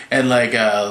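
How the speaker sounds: ordinary delivery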